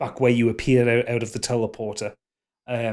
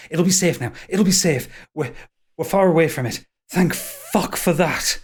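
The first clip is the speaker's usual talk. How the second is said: breathless relief; 5 semitones higher than usual. Acted. Elf relieved after escape.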